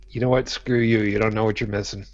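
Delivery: usual speaking voice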